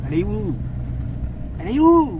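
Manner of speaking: appel de plus en plus fort et aigu; appel à voix forte. Real, not acted. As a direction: lance la question au loin, en montant. Cherche sa copine partie devant. (appeler quelqu'un en criant)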